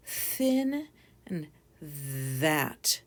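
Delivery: very slow "thin and that", each word drawn out; drawn-out model of "thin" and "that"; slow contrast. Shown as a demonstration. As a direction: stretch out "thin" and "that" to show the difference. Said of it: "Did you hear the difference?".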